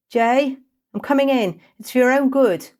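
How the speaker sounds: high, loud call through door; loud warning call. Acted. Kesa warning Jay before barging in, loud call. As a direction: announce entry loudly.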